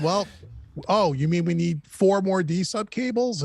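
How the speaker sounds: ordinary delivery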